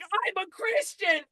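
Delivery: high, slow, stretched mimicry; possessed girl. Possessed girl protests to exorcist, high mimicked wail. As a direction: wail it high and drawn out, imitating. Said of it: "And she's like".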